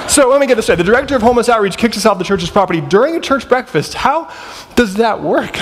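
high-pitched, baffled recap; incredulous questioning. Retold or imitated. Homeless pair questioning the outreach director kicking them out, incredulous.

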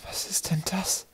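slow, hushed whisper. A narrator's reading. Boy asks about the strange creature, whispering. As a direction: whisper the question very softly, drawn out. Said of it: "fragte er Knorpel flüsternd".

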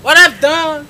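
high, loud mock cry; screaming to God. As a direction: holler it as a wronged woman's plea. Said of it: "she was screaming like".

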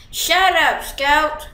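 loud, high telling-off; annoyed shout. Real, not acted. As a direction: snap loudly at a noisy teammate. Telling off a noisy player.

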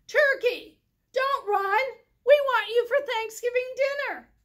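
high, loud chasing call; shouting after him. Acted. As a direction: yell after the running turkey. Moose chasing turkey.

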